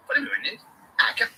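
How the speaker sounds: usual speaking voice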